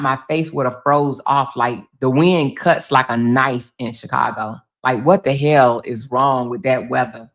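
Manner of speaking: normal talking voice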